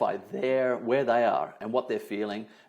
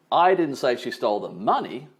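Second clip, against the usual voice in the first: money punched at the end; stress on MONEY; 4 dB louder than usual. Shown as a demonstration. Trainer implying she took something else, stress on MONEY. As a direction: save the weight for the word money. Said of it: "putting an emphasis on different words".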